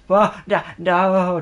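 loud, high cry of protest; alarmed. Real, not acted. Gamer about to ride into something, alarmed cry. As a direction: cry out to stop a mishap.

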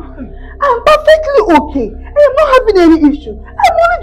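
wife tearfully insists nothing is wrong with her; laughing through tears. Acted. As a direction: break between a laugh and a sob.

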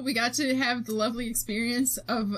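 ordinary talk, nothing marked

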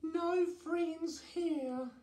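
very high, slow little voice; high-pitched slow storybook dragon; dragon character Dora. A narrator's reading. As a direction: draw it out slowly in a high character voice. Dora the dragon, dejected, speaks.

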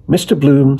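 usual speaking voice

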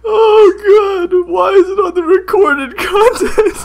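high, tearful laughing wail; crying with laughter. Real, not acted. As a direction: wail helplessly through the laughter. Overcome by laughter at the picture.

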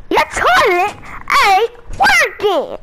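high, slow immigrant dad voice. Acted. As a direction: play the clueless dad, high and drawn out. Dad voice again.

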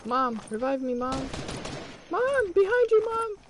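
high panicked pleading; hyped gamer bro. Real, not acted. Begging teammate mid-fight.

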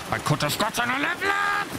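high, strained frustrated cry; anguished high-pitched complaint; 1 frustrated wail. Real, not acted. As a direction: wail it at the lost level. Plain then shouted repeat.

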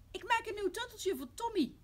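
put-on high, loud childlike reply; little girl's voice. Lotje explains herself to angry mama, high child voice. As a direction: pipe up like a small girl. Girl answers her angry mother.

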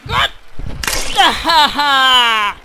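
usual speaking voice

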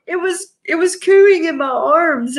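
high, gushing mock-tearful voice; retold teary gushing. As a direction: gush about the chicken as if about to cry. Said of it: "I was like".

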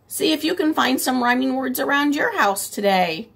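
ordinary talk

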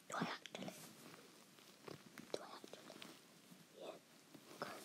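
hushed unvoiced whisper. Real, not acted. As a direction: breathe the words, no voice. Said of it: "Why are you whispering".

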